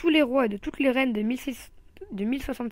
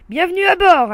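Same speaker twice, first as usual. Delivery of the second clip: big booming bellow, much higher and far louder; 8 semitones higher, 15 dB louder than usual. A narrator's reading. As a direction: roar the welcome at the door. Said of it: "a-t-il beuglé".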